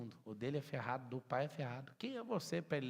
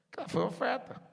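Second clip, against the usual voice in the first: much higher, louder pitched protest; defiant insistence; 11 semitones higher, 8 dB louder than usual. Retold or imitated. Imitating someone defending a lost loan, high and loud. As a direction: insist it was an offering.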